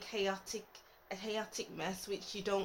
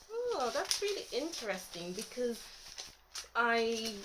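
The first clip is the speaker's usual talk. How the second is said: ordinary talk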